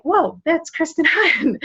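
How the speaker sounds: high, slow, wide-eyed whoa; be stunned to recognize her; mock disbelief at an old friend; amazed recognition. Surprise at not recognizing friend.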